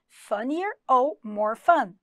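drawn-out teaching repeat; slow model words. Shown as a demonstration. Teacher to students, slow model reading. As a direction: lay out both options slowly. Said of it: "pode usar os dois".

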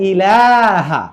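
ইলাহা with a short ই and a very slow, drawn-out লা; slow Quranic recitation. Shown as a demonstration. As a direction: keep the ই of ইলাহা short and stretch the লা slowly. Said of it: "এখানে ইতে টানা যাবে না".

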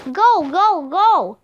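loud, repeated shouted commands; shouting. A narrator's reading. Jack chasing the wolf away, loud shouting. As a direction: bark them at the wolf to scare it off. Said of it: "shouted Jack".

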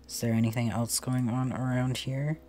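normal talking voice

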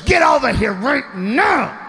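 slow, high-pitched angry command; mock furious drawn-out yell; pissed wife. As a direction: imitate a wife ordering her husband over, stretching each word. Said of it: "She was pissed".

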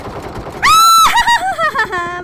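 loud high shriek breaking into laughter; scream-laugh at full volume, very high; piercing squeal tumbling into a big laugh. Real, not acted. Enemy attack during live game.

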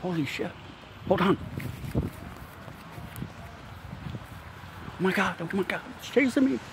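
high, hushed pretend fright; fake panic. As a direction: act spooked by something unseen. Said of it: "Ah, I'm just playing".

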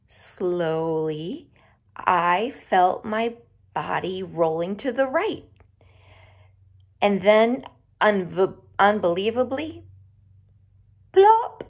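usual speaking voice

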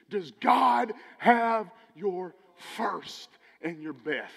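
drawn out, leaning hard on does God have; slow pointed question. Real, not acted.

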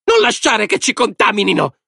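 high-pitched, alarmed shriek; very high hostile woman's cry. A narrator's reading. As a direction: shriek a protest from the crowd. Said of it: "strillò la donna tarchiata".

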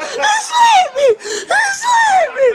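high-pitched laughing shout; laughing yell. Real, not acted. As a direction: yelp through laughter. Knocked over, laughing.

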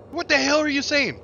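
high, loud baffled re-enactment; exasperated retold question. Retelling her confusion aboard ship, high exasperated cry. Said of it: "I was like".

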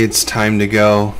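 ordinary talk

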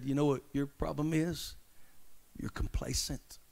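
soft, kindly whisper; tender hushed rebuke. Pretend. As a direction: whisper it gently in their ear. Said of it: "whispered ever so kindly".

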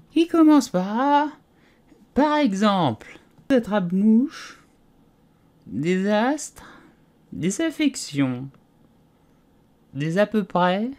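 a dozy little animal insisting it was awake; sleepy Dormouse. A narrator's reading. Dormouse defending himself to the tea party, drowsy and high.

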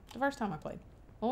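ordinary talk, nothing marked

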